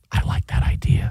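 soft whisper. Acted. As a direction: whisper it to your cohost. Whispering podcast bit.

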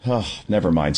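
ordinary talk, nothing marked

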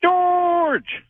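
high, drawn-out holler; called-out greeting. Real, not acted. Caller greeting the radio host, hollered high and long. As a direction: sing out the name, long and high.

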